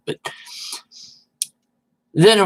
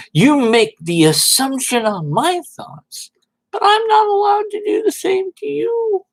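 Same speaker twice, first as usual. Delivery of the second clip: much higher, whiny mock complaint; 3 dB louder than usual. Mocking Red Dead's complaint in a high whine. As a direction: whine it like a hurt zealot.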